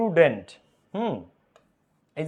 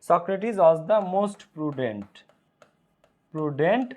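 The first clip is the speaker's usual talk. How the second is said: slow dictation; much slower than usual. Shown as a demonstration. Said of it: "teacher dictates slowly".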